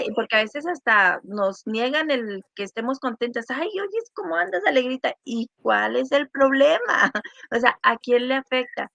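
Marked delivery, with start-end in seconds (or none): 3.5-5.1 high, sing-song teasing voice; mocking tease. Imitating people teasing a woman for being happy, high and mocking. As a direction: needle her for being cheerful.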